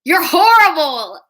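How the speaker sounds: high, loud mock accusation; retold shout. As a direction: shout it out in playful outrage. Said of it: "I just shouted out".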